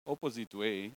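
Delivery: usual speaking voice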